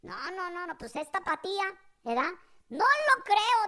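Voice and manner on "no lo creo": higher, louder disbelief; mock outraged mother. Mother hearing Jalisco lost, mock outrage. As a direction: protest it loudly as an indignant Mom. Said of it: "casi le dio una depresión a mi jefa".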